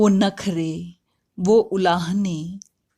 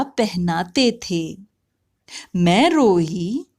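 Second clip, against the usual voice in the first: slow, lilting Hindi poetry recital; poem recitation; 3 semitones higher, 3 dB louder than usual. Acted. Mother shares a poem about her lost childhood, lilting recitation. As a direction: recite it in drawn-out, rising-and-falling verse cadence.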